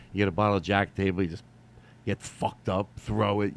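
usual speaking voice

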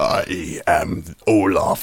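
low, slow, booming snowman; deep Olaf voice. Retold or imitated. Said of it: "his voice got real deep".

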